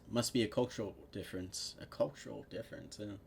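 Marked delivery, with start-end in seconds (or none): none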